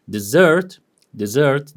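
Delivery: deSERT, weight on the last syllable; weak first syllable in desert, hit SERT; desert stressed at the back, as a verb; stress on second syllable. Shown as a demonstration. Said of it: "the change in the stress changes the meaning".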